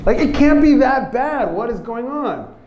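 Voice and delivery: high, exasperated outburst; fed up. Acted. Roommate confronting the crying boy, fed up. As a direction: demand an answer, pitch jumping up. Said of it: "so fed up with it".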